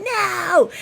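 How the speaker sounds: high, loud cry of no; yelled no. Retold or imitated. Neighbors hear her yelling no at the rat. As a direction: shout it like you saw a rat. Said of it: "heard me yelling".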